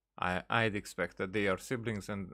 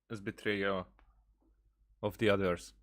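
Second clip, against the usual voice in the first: ordinary talk, nothing marked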